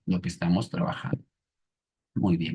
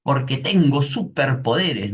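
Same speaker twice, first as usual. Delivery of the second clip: niño orgulloso; 6 semitones higher, 4 dB louder, slower than usual. Retold or imitated. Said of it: "El niño le responde".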